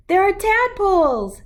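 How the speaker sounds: put-on high, loud child shout; shouting across the pond, thrilled; excited shout. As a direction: call out the discovery with delight. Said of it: "she shouted".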